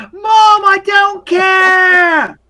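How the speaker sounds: put-on very high, loud child yell; drawn-out, high-pitched bratty shout; little boy yelling. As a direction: yell back at Mom like a stroppy kid. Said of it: "and he yells".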